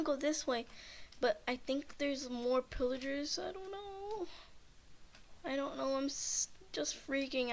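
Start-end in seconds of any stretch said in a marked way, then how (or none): 3.4-4.3 teary whimper. Real, not acted. Panicked after pillager chase.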